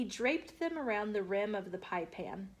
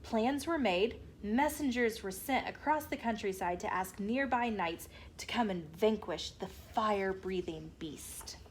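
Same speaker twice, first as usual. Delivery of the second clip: ordinary delivery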